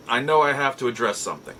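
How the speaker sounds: usual speaking voice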